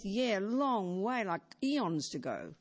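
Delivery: very slow, unbothered drawl; lazy complacency. Retold or imitated. Teacher voicing our careless view of death, very slow. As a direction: stretch it out like death is forever away.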